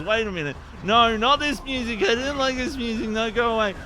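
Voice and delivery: pushing the tune away, half laughing; real exasperated protest. Real, not acted. Streamer protests the game's returning music, exasperated.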